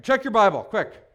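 normal talking voice

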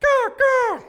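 high, loud bird squawk; raven caw. Shown as a demonstration. Preacher demonstrates the Greek word, raven caw. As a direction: imitate a cawing raven at high pitch. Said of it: "to croak or caw like a raven".

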